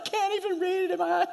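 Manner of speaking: high, quick imitation of a tearful wail; mock crying. Wife too tearful to read the Bible, mock crying. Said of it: "She's crying. She's like".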